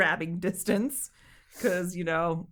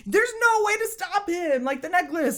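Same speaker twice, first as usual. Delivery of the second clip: higher-pitched mock exasperation; pissed-off outburst, acted; 10 semitones higher, 3 dB louder than usual. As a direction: rant it as if fed up with them. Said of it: "I would have been so pissed".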